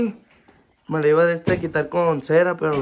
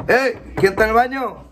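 calling out; 8 semitones higher than usual. Real, not acted. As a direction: shout at someone in the bathroom. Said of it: "Está regañando a la chapita".

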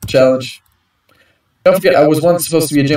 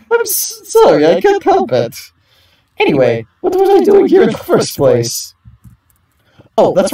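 much higher giddy character voice; flustered celebrity; 12 semitones higher than usual. Acted. Risa apologizing to the group, high giddy voice. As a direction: gush like a scatterbrained starlet.

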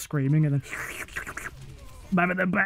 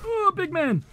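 high put-on big man voice; squeaky mocking big man; mock taunt; 13 semitones higher than usual. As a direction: tease the enemy in a silly voice. Taunting voice in game.

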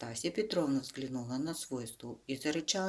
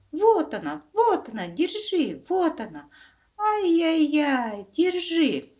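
much higher, louder panicked squeals; shrieking cub; 10 dB louder than usual. Acted. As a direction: scream like he's being attacked. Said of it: "завизжал, как будто его режут".

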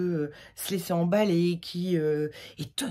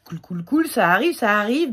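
louder, eager rush of excitement; loud happy anticipation; excited; 5 semitones higher, 9 dB louder than usual. Real, not acted. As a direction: cheer it on, summer's coming. Looking forward to summer.

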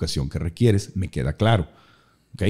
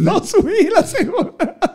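laughing; slower than usual. Real, not acted. Recalling, laughing.

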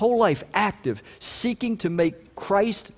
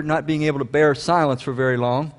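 ordinary talk

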